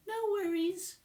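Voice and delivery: very high, airy "No worries" in a put-on voice; mock chirpy reply. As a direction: squeak it out like a cheery cashier. Acting out bored replies.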